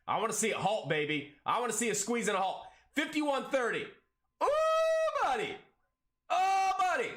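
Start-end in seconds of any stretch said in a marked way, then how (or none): 4.4-7.1 much higher, excited ooh; thrilled. Real, not acted. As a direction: cheer the price spike on. Stock squeezing up live.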